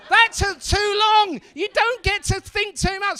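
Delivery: high, loud scolding; indignant shouting. Acted. Comedian scolding the crowd for thinking too long, indignant shout. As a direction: tell the room off in mock outrage.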